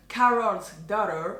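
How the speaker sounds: slow, careful American English on Carol's Daughter; attempted American accent. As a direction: try an American accent on the brand name. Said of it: "Ne vous moquez pas de mon accent".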